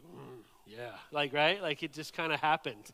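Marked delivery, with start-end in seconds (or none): 0.0-1.0 low, much softer "mm, yeah" of quiet approval; a gut-level nod from every man in the room, murmured; knowing male grunt. Retold or imitated. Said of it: "every man ... was like".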